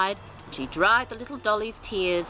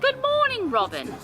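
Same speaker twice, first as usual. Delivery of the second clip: put-on high, gentle old lady greeting; grandmother voice. Grandmother greets the robin, high and gentle.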